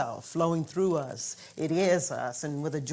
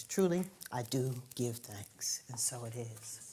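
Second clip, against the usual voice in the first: hushed, breathy closing prayer; soft whispered gratitude; whispered thanks; 3 semitones lower, 6 dB quieter than usual. Real, not acted.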